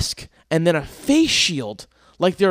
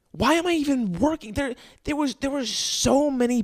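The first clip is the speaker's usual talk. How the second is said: much higher, disbelieving repeat; exasperated; 10 semitones higher, 3 dB quieter than usual. Real, not acted. Ranting to listeners about his job, exasperated high repeat. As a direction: throw it up in exasperation.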